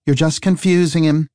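lightly voiced woman detective. Detective scolds the lumberjack.

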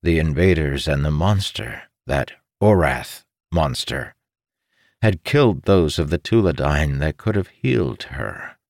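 ordinary delivery, nothing marked

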